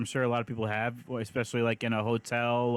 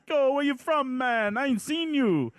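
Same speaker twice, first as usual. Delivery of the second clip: high, light imitation of a Caribbean local; 11 semitones higher, 3 dB louder than usual. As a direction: voice a friendly islander asking around.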